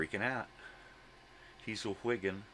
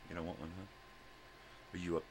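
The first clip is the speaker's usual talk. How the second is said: ordinary talk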